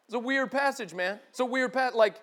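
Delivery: ordinary delivery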